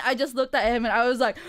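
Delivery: ordinary delivery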